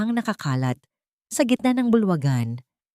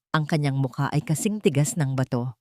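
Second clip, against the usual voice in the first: usual speaking voice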